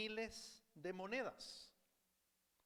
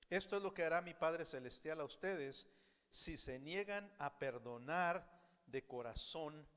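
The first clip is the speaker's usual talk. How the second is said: normal talking voice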